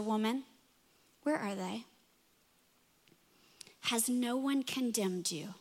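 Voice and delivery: gentle Jesus. Pretend. Jesus to accused woman.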